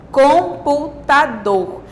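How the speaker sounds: "computador" slowly, COM-PU-TA-DOR; divided into syllables. Shown as a demonstration. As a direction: give each of the four syllables of "computador" its own beat. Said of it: "as palavras em sílabas". ("computador" devagar, sílaba por sílaba)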